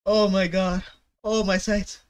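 usual speaking voice

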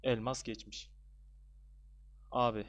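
normal talking voice

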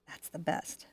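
soft whispered aside; hushed little whisper of delight. Real, not acted. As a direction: breathe it out to yourself. Whispered aside while painting.

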